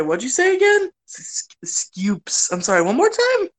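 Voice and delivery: high teasing ask-again; mock incredulous. Players teasing the GM, high mock-puzzled voice. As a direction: play dumb to hear it again. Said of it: "so they're like".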